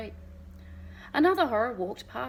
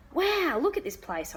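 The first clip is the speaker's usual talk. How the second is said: higher, amazed exclamation; excited cry; 7 semitones higher than usual. A narrator's reading. Boy entering the park, excited and higher. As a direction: gasp at the sight. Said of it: "I cried".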